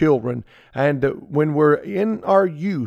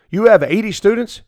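higher, louder disbelieving question; incredulous question; 6 semitones higher, 4 dB louder than usual. Retold or imitated. Visitor questioning the pastor, higher and incredulous. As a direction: ask as if it can't be true. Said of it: "people that have said things like this".

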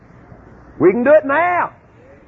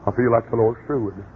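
mock pious excuse; 13 semitones lower, 5 dB quieter than usual. A pastor telling his church he's leaving, in a low mock-pious voice. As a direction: sigh it out like a tired pastor. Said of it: "I'd say".

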